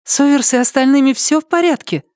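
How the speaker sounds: put-on high young girl's voice; anxious girl, pitched high. Amena asks about Overse, voiced.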